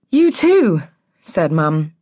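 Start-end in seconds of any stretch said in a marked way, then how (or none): 0.1-0.8 high, polite little reply; strained politeness. A narrator's reading. Mum returning the neighbour's greeting, politely high. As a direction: answer politely, pitched well up.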